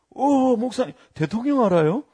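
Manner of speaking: high-pitched, astonished bystander; mock wide-eyed question; impressed surprise. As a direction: imitate someone amazed at a famous acquaintance. Imitated bystander impressed by pastor.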